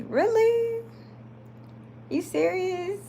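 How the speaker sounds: high, incredulous disbelief; can't believe it, really?; surprised. Real, not acted. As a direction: pitch shoots up in real surprise. Reacting to a viewer comment.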